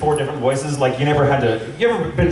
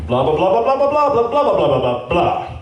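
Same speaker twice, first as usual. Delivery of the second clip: singsong gibberish read, rising then falling; mock copy read; 2 semitones higher than usual. Actor reading ad copy behind glass, mock performance.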